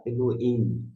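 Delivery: "Elohim" with the h dropped, as e-lo-EEM; variant pronunciation. Retold or imitated. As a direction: glide from lo straight into im in "Elohim". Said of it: "outras pessoas que falam".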